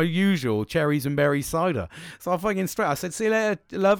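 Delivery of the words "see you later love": high, loud cheerio, acted out; imitated breezy goodbye, pitched up; breezy parting call. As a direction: call it back over your shoulder. Retold parting call to barmaid.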